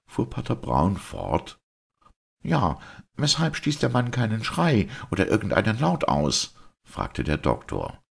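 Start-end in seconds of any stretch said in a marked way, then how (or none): 2.4-6.6 high-pitched, puzzled doctor voice; questioning doctor. Acted. Doctor putting his first question to the priest, puzzled.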